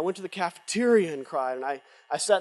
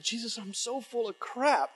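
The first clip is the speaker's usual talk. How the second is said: retold desperate prayer; 5 semitones higher, 6 dB quieter than usual. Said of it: "I said those words to Jesus".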